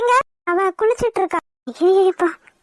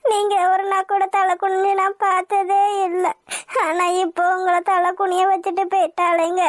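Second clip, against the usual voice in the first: crying. Acted. Wife mourns her husband's lost honour, crying. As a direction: keep weeping through the words. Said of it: "அழுகாதம்மா".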